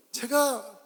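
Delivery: ordinary talk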